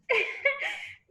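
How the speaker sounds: a high, teary half-laugh half-sob; tearful laugh. Real, not acted. Teacher to her class, laughing through tears. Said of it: "I'm keeping it together".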